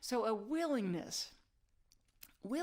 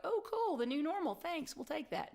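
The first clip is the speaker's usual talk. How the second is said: higher, airy sing-out of naive thanks; mock delighted acceptance; 7 semitones higher than usual. Audience voiced taking a handed-over new normal, mock delighted. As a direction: play the easily pleased recipient.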